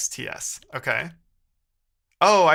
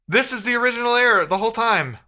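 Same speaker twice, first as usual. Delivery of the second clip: higher, louder eureka; excited realisation; 8 semitones higher, 6 dB louder than usual. Real, not acted. Coder finally finding the real bug, excited and loud. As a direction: blurt the discovery.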